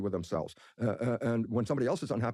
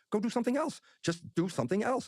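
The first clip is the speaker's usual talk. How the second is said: upbeat urging; 10 semitones higher than usual. Retold or imitated. Urging himself to choose.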